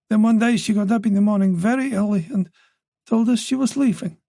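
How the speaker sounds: put-on an elderly mother, voice lifted by worry; anxious old mother voice. Wife's long account.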